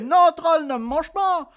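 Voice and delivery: high pleading goat. Acted. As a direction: cry out for mercy. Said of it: "le moyen bouc".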